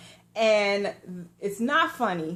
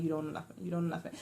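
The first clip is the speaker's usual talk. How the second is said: soft, low repeated mutter; muttering under breath; 3 semitones lower, 9 dB quieter, much faster than usual. Retold or imitated. Friend on the curb coaching her, muttering under his breath. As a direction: mumble it under your breath, over and over. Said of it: "Van is still muddling".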